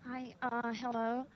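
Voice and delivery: ordinary delivery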